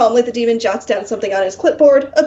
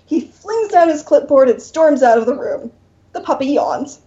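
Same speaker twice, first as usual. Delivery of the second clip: ordinary talk, nothing marked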